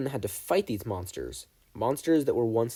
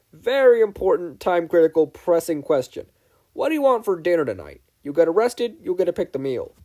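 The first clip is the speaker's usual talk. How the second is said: mock-urgent loud announcement; 5 semitones higher, 7 dB louder than usual. Suze's mock-urgent dinner announcement.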